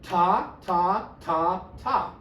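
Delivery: slow, evenly spaced ta ta ta ta; teacher chanting the rhythm; rhythm syllables. Shown as a demonstration. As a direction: count out each beat like a drum. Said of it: "Your drum part is".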